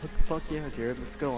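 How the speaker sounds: ordinary talk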